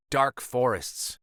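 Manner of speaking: usual speaking voice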